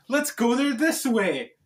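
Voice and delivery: ordinary delivery, nothing marked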